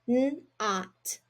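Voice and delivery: n, ot, sound by sound; sounding out not. Shown as a demonstration. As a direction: break "not" into n and ot. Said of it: "read the first word first".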